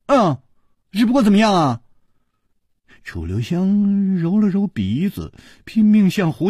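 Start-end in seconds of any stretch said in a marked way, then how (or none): 0.1-1.8 higher, tense prompting question; forced cheer. A narrator's reading. Princess fearing a refusal, forcing a smile as she asks. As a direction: press for the rest with strained lightness. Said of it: "她强笑着说".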